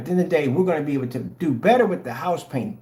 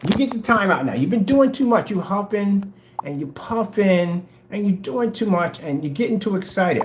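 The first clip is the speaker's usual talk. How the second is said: mock scolding parent; 7 semitones higher, 3 dB louder than usual. Scolding critic like a child.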